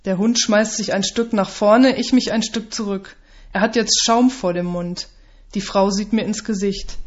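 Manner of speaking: plain reading voice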